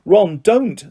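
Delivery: a touch of anger at a friend; angry rebuke. A narrator's reading. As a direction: scold him to stop. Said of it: "said Hermione angrily".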